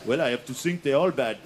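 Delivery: low, badly faked French accent; hammy French accent, low; terrible French accent. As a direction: answer as a French farmer, deep voice. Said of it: "that accent's really terrible".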